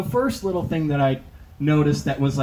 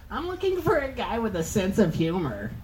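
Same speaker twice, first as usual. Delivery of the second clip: mock girl voice; 6 semitones higher, 4 dB quieter than usual. Girl's first-date line quoted.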